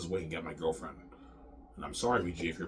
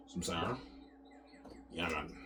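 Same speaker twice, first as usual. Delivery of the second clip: ordinary talk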